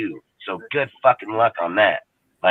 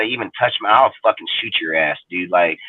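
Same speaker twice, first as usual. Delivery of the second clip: ordinary talk, nothing marked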